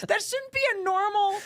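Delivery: ordinary talk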